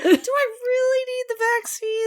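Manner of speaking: high whiny sobbing plea; mock crying plea. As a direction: wail and beg like a big baby. Playing patient getting shot.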